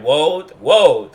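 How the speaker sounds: road said as woad, R turned to W. Retold or imitated. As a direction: say woad for road, like a kid who can't say R. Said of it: "things like".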